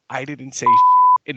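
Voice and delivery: loud, slow retold comeback; loud retort. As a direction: blurt it out loud and slow, on reflex. Retold reply to bar bully.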